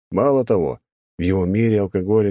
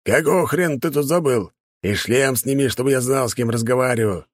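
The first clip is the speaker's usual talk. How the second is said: rough, hostile bark, pitched higher; 6 semitones higher than usual. Acted. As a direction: snap at the stranger from the window. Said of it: "рявкнул кто-то из окна дома".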